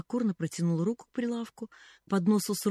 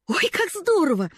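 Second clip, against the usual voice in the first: high, loud delighted cry; girl shrieking with delight at the idea; joyful exclaim; 12 semitones higher, 7 dB louder than usual. A narrator's reading. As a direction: burst out with joy. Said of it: "воскликнула она радостно".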